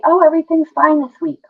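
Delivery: light cheery patient brush-off; chirpy reassurance, everything's fine. Retold or imitated. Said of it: "Even if they say".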